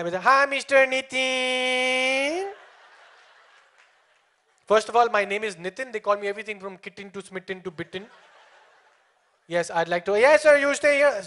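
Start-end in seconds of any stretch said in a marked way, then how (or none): 0.0-2.5 high greeting with a hugely drawn-out last word; mock customer-service singsong; over-eager call taker. 10.2-11.2 high, loud phone-operator patter; mock over-eager customer service; interrupting call taker. Call taker cutting the caller off.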